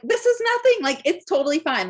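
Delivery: high, loud singsong brush-off; breezy dismissal. Retold or imitated. Her amused dismissive reaction, retold.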